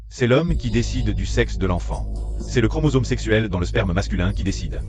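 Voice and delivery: ordinary talk, nothing marked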